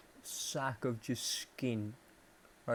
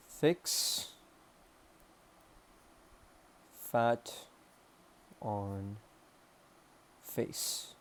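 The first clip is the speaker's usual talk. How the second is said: word by word, long pauses; dictating while writing; much slower than usual. Real, not acted. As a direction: spell out a note to yourself as you write. Says words while writing them.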